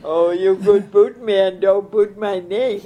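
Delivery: loud, laughing tease; teasing laugh. Real, not acted. Elder laughing as she teases the interviewer. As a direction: laugh as you tease him.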